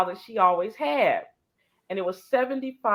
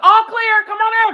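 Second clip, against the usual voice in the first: high, loud call; loud all clear; 10 dB louder than usual. Retold or imitated. Calling Gail into the empty bathroom, high and loud. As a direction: sing out that the coast is clear.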